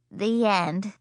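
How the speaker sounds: ordinary delivery, nothing marked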